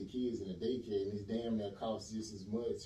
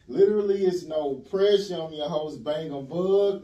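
loud, raised-pitch show opener; host intro; 6 semitones higher, 13 dB louder than usual. Real, not acted. Host opening the show with a special guest, loud announcer delivery. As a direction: kick off the show loud and punchy.

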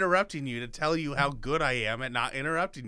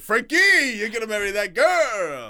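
higher, louder Italian-Irish wise guy; Irish-Italian mock accent; 7 semitones higher, 8 dB louder than usual. Co-host reprising Howie's line in a loud Irish-Italian accent. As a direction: toss it off like a pushy Italian pal. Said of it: "Irish or, like, Italian stuff".

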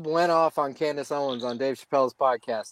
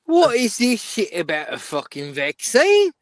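put-on Australian accent, higher and gruff; aussie tradesman; 8 semitones higher than usual. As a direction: play a fed-up Aussie bloke, Australian accent. Mocking Aussie anti-vaxxer.

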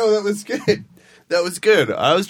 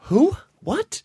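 confused surprise; 13 semitones higher than usual. Retold or imitated. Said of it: "And I was like".

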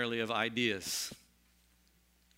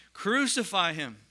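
higher, louder, slow crowd cry; 6 semitones higher, 6 dB louder, slower than usual. Acted. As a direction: call it out like an angry crowd. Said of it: "they cried out again".

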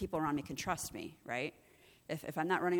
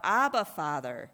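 louder cry of Abba, Father; mildly raised cry; 6 dB louder than usual. Retold or imitated. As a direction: call out to the Father. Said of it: "He cries out".

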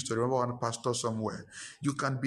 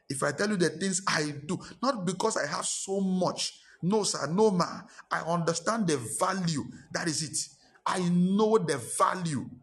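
fervent preaching; 6 semitones higher than usual. Acted. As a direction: preach it out with full conviction. Preacher rising to full force.

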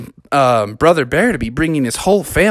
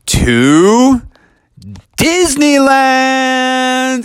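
high, stretched-out reveal; excited announcement; 11 semitones higher, 4 dB louder, much slower than usual. Acted. Dad revealing the trip to the kids, drawn-out excited reveal. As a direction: draw the word out like a big surprise.

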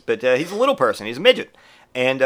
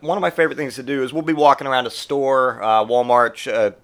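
ordinary talk, nothing marked

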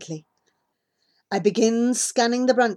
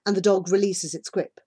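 usual speaking voice

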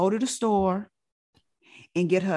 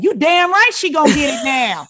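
triumphant auntie; 7 semitones higher, 10 dB louder than usual. Retold or imitated.

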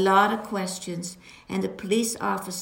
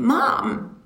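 sharp alarmed cry, louder; retold scream; 4 semitones higher, 7 dB louder than usual. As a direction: call out in sudden alarm. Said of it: "Wendy screamed".

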